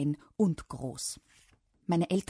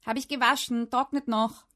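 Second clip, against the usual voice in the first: higher, raised dad's call; shouted; 6 semitones higher, 5 dB louder than usual. A narrator's reading. Dad shouting back to his child, shouted. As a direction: call it out across the flat. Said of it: "schrie diesmal der Papa".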